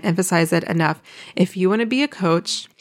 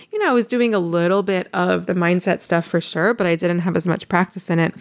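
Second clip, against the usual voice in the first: ordinary talk, nothing marked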